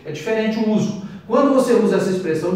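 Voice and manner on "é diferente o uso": ordinary talk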